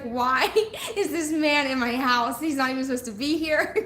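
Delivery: mock alarm through a laugh; retelling her shock while laughing; laughing disbelief. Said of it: "I was like".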